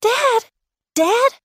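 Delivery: high excited calls of Dad; children calling out to their father. Acted. Children greeting returning father.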